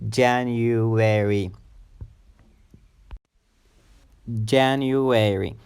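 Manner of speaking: JAN-uary, slow, weight on JAN; slow teaching model of JAN-uary; first-syllable stress. Shown as a demonstration. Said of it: "stress or emphasis is on the first syllable".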